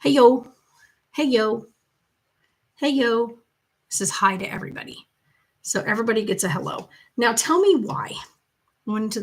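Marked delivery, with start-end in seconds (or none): 0.0-3.4 put-on toddler hailing each passer-by, first call loudest; imitated toddler greeting. Grandma imitating toddler saying hi to every shopper, bright repeated greeting. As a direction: call out like a little boy greeting strangers. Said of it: "he goes".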